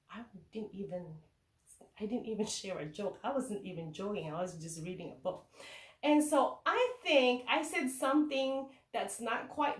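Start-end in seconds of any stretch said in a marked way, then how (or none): 0.1-5.4 hushed, low aside; private thought. Retold or imitated. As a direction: drop to a low murmur, thinking to yourself. Teacher voices her puzzled private thought.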